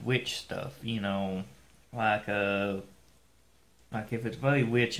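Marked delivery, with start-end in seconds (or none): 3.9-5.0 muffled behind fingers; speaking with hand over mouth. Real, not acted. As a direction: hand partly covering lips. Seen 1: Hand raised over mouth and chin early on, then lowered to gesture.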